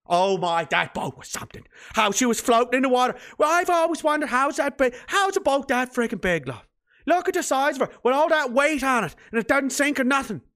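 hammed-up Newfoundland gossip, amazed; Newfoundland accent. Neighbour asks Brenda about the huge boat, hammed-up Newfoundland accent. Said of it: "that's mostly a Newfoundland accent".

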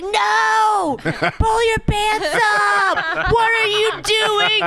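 hammed-up panicked father yell; yelling dad. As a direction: shout at the kid to pull his pants up. Mom retells husband yelling at son.

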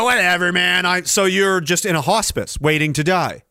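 ordinary delivery

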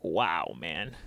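normal talking voice

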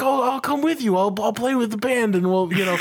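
put-on high, eager drunk offer. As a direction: push to join the band, pitched well up. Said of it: "And he's like".

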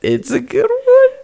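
very high, slow falsetto; sing-song high teasing. Pretend.

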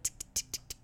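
whispered tick tick of the tattoo needle; tapping sound. Retold or imitated. Imitating the tattoo tapping in a soft voiceless tick. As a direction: tap it out under the breath, soft and voiceless.

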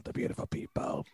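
breathy whispered song line; whispering. Pretend.

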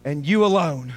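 low, hushed declaration. Acted. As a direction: drop to a near-whisper of awe. Soft worship after loud preaching.

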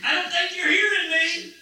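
high, softened, put-on quote voice; imitating another speaker. Preacher quotes that guy to the congregation, imitated high voice. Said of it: "what did that guy say the other day".

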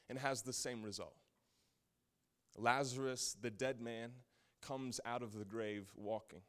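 ordinary talk, nothing marked

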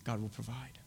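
very soft, low whisper. Acted. Abraham to his son before the sacrifice, whispered. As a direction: breathe it out under your voice. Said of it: "Just whisper it".

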